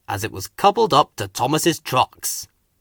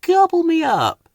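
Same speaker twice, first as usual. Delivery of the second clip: Hank voice. Acted. Hank offers help.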